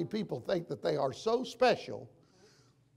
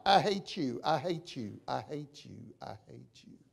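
each repeat lower and softer, dying away; fading echo; 5 semitones lower, 7 dB quieter than usual. Retold or imitated. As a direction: echo off into the distance. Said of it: "the canyon hollered back".

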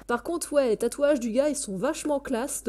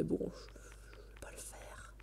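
whispering; 6 semitones lower, 16 dB quieter, slower than usual. Real, not acted. Muttered aside to himself.

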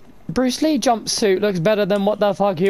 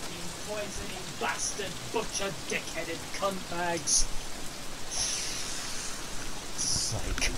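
muttered curses; 14 dB quieter than usual. Real, not acted. Muttered insults at game.

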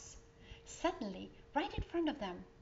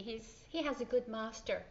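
usual speaking voice

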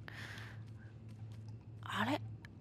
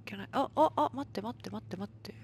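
whispering; 2 semitones lower, 3 dB quieter, much faster than usual. Real, not acted. Sneaking quietly in the game.

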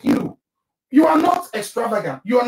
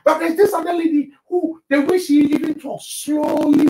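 ordinary talk

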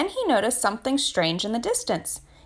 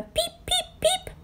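chick peeps; 3 dB quieter, slower than usual. A narrator's reading. Chick hatching, peeps imitated.

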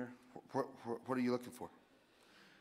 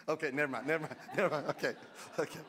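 ordinary talk

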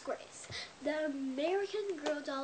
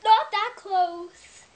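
loud protest; 7 semitones higher, 10 dB louder, much slower than usual. Real, not acted. Telling dad how to film.

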